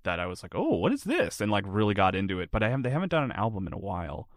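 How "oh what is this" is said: higher-pitched, lightly acted surprise; mock intrigued discovery. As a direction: act out stumbling on something new. Recalls discovering a new band.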